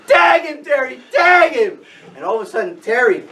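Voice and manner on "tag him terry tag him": high-pitched urgent yell; retold field screaming. Whole field screaming at Terry, high urgent yell. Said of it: "like, screaming".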